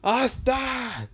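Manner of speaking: angry yell building to a high scream; scold, then scream the name out; high, loud, exasperated shouting. A narrator's reading. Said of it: "He screams in this".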